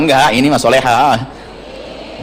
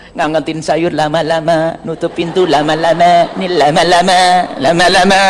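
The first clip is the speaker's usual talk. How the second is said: mock dawdling; 2 semitones higher than usual. Mimicking a dawdling wife.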